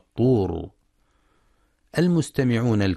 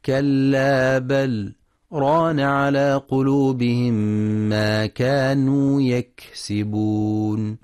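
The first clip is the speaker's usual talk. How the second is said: slow, melodic tajweed chant; Quran recitation; 3 semitones higher, slower than usual. Shown as a demonstration. Teacher recites the verse for students, slow tajweed chant. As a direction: recite the verse slowly with long held vowels. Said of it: "وفي قوله تعالى".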